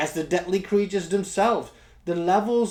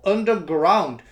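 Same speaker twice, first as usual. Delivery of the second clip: usual speaking voice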